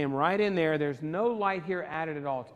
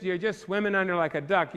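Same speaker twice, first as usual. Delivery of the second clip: ordinary talk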